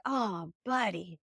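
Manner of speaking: slow, tender commiseration; simple sympathy. Retold or imitated. Husband consoling a child with one simple line, tender sympathy. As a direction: draw it out gently and leave it there. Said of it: "he just has this real simple".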